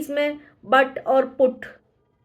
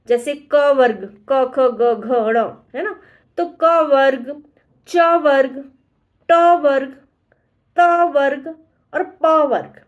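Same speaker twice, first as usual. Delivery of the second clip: क वर्ग, च वर्ग, ट वर्ग each said slowly and a bit louder; slow emphatic listing; 4 dB louder, slower than usual. Shown as a demonstration. Teacher slowly lists the letter groups for students. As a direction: count out the letter groups deliberately. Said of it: "teacher listing the varga rows".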